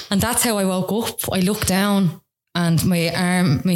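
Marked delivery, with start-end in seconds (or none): 0.0-2.2 laughing through the words. Real, not acted. Laughing while telling the host about waking up. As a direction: giggle as you tell it.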